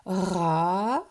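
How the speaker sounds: long rising RA; "ra" held, climbing at the end; syllable stretched. Shown as a demonstration. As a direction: draw out "ra" as a lone syllable. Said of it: "on entend la syllabe".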